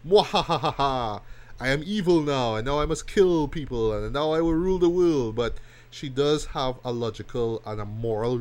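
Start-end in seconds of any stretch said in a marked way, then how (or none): 0.1-1.2 slow hammed-up evil cackle; evil villain laugh. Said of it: "this uber villain". 1.6-5.4 hammed-up cartoon villain, rising louder; mock villain boasting of evil plans; uber villain. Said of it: "this uber villain".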